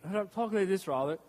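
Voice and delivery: clipped British accent, lip held still; stiff British accent. Shown as a demonstration. Pastor showing how the British talk, stiff British accent. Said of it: "the British have a phrase called a stiff upper lip".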